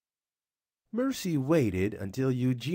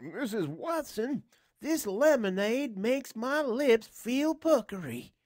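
higher put-on voice for the husband; Mister Watson's voice; 13 semitones higher than usual. A narrator's reading. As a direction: voice him higher than the narrator. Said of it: "Mister Watson said".

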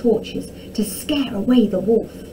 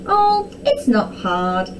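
chuckling; 4 semitones higher, slower than usual. A narrator's reading. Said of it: "chuckled Arabella".

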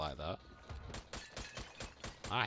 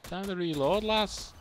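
louder playful northern English imitation; northern accent; 9 semitones higher, 5 dB louder than usual. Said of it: "Northern accent hype".

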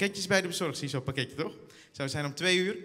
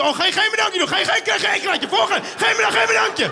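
high, loud ranting at the driver; hammed-up angry yelling; mock road rage; 13 semitones higher, 11 dB louder than usual. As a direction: berate the rude driver.

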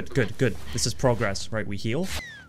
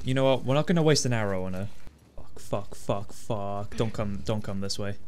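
usual speaking voice

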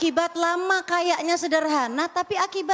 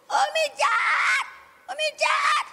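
put-on child's tantrum shout; 12 semitones higher than usual. Child yelling at mother in the supermarket, shrill tantrum. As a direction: scream it like a kid throwing a fit.

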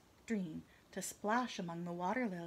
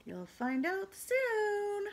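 teasing; 11 semitones higher, 4 dB louder, much slower than usual. Real, not acted. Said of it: "teasing the children".

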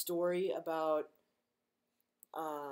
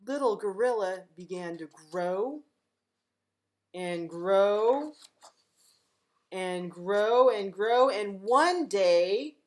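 growing louder and slower each grow; building up; 8 dB louder, slower than usual. A narrator's reading. Librarian reading to kids, swelling louder and slower. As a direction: swell it bigger with every grow.

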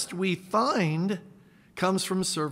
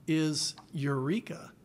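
ordinary delivery

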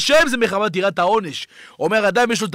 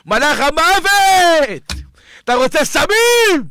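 very slow, louder, higher warning; booming warning; 5 semitones higher, 3 dB louder, much slower than usual. Acted. Preacher warning drug users of death, booming. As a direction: draw out the alarm.

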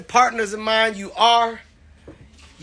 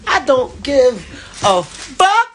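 hyped up; 7 semitones higher, slower than usual. Real, not acted. Hyped closing outburst.

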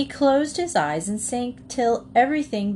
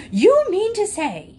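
high, loud, furious outburst; outraged scream; 13 semitones higher, 6 dB louder than usual. A narrator's reading. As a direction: explode in a shrieking fit of temper. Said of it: "he screamed".

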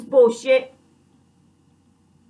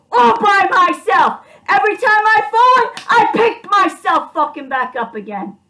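defiant shout; 5 semitones higher, 4 dB louder than usual. Real, not acted. Telling enemies the speaker always gets back up, defiant shouting. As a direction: hammer each word home louder.

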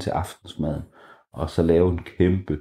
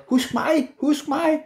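high, pleading little voice; Kaj calling; slower than usual. A narrator's reading. Kaj begs Bamse to grab him, high and pleading. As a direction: call out to not be forgotten. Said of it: "der stod Kaj og sagde".

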